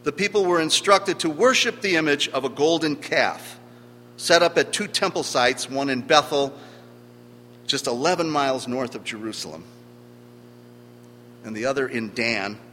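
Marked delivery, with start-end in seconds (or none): none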